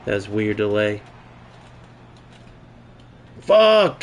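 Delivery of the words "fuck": frustrated curse. Real, not acted. Frustration at a missed play.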